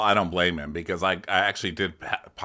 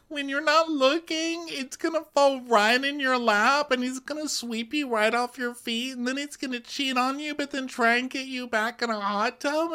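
put-on very high squeaky girly voice; squeaky Amanda voice. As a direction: gush it all up in a tiny high voice. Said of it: "And Amanda's like".

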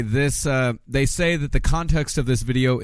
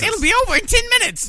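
much higher, shouted, fast Canadian rant; Rob Ford angry shout; 3 dB louder, faster than usual. Retold or imitated. Cohost mimics the mayor's angry tirade in a high shout. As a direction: bellow it like a furious mayor. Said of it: "practice his high-pitched Canadian voice".

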